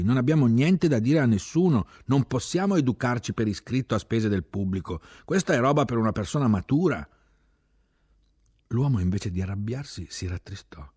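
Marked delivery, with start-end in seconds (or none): 0.0-7.1 loud, fast, indignant refusal; heated protest. Acted. Young man refuses to write the editorial, loud and indignant. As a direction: push back hard and fast.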